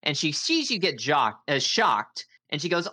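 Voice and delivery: ordinary delivery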